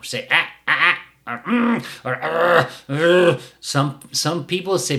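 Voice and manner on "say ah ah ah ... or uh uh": short sharp ah and uh sounds, getting louder; mock trainer's uh-uh grunts; correction noises. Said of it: "some trainers say".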